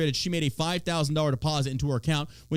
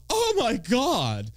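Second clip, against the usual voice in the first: higher, louder, slow scandalized gasping; mock pearl clutcher; 4 semitones higher, 4 dB louder, slower than usual. Mocking shocked voters who clutch pearls, hammed-up horror. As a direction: ham up a shocked churchgoer. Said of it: "to clutch your pearls".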